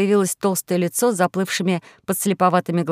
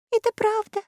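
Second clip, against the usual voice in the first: tiny, very high timid voice; high-pitched fearful trembling; 3 dB quieter than usual. A narrator's reading. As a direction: confirm it in a frightened little squeak. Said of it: "дрожащим голосом подтвердила Заури".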